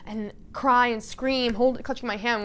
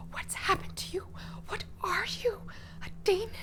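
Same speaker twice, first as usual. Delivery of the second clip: high, whispery disbelief; horrified breathy questioning; 11 semitones higher, 4 dB quieter than usual. Acted. Odette to Milos after seeing him with the prince, horrified. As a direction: gasp it out high and breathy, appalled.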